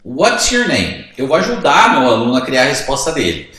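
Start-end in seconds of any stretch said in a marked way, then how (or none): 0.0-1.1 slow, clear classroom English; slow model question. Shown as a demonstration. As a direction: say it slowly so the student can follow. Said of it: "primeira pergunta". (pergunta em inglês bem devagar, de professor)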